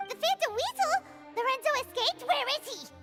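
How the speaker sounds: high, startled questioning; alarmed. Acted. Guide fearing the captured thief escaped, alarmed. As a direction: blurt it in shocked alarm.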